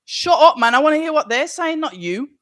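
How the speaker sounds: loud, high snap at the commentator; annoyed shout. Real, not acted. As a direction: shout him down so you can hear. Telling commentator on stream off.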